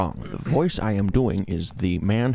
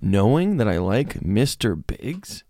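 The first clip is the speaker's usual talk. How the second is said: hammed-up fury, voice climbing; mock outrage; 7 semitones higher than usual.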